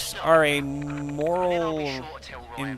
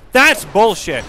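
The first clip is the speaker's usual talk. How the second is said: raised, outraged; higher and louder on the repeat; louder indignant repeat; 5 semitones higher, 7 dB louder, slower than usual. Real, not acted. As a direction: say it again louder, fed up.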